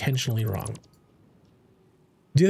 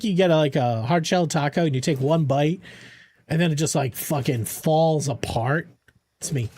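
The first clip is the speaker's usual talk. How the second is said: usual speaking voice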